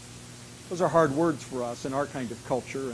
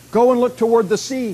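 raised, louder voice of command; commanding prophet; 7 semitones higher, 10 dB louder than usual. Acted. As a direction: send a servant off with a loud order. Elijah orders his servant.

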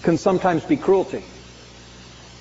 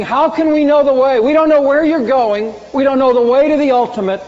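higher, louder questioning voice; pleading disciple; 6 semitones higher, 4 dB louder than usual. Retold or imitated. Philip asking Jesus the way, raised and pleading. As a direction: ask it like a lost disciple begging. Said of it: "Philip saying".

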